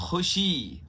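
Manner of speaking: खुशी with a throaty rasping ख; nukta kh in khushi. Shown as a demonstration. Teacher showing correct खुशी, throaty kh. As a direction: say खुशी with the Urdu x sound at the start. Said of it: "तो पहला शब्द है".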